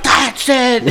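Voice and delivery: hammed-up high shout; mock furious yell. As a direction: blow up like a tiny enraged man. Uncle losing temper again.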